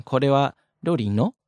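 ロリの with the final の lifted up; rising question intonation. Shown as a demonstration. Teacher demonstrates asking a question, rising end on の. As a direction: take の upward to make これはロリの a question. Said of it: "always take it up at the end".

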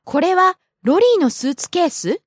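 これはロリーのスーツケース with the final syllable swept up into a question; rising question intonation. Shown as a demonstration. Student shows host a casual question, rising question intonation. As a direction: lift the end of スーツケース upward as a question. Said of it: "took the intonation up at the end".

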